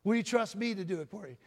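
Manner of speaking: ordinary delivery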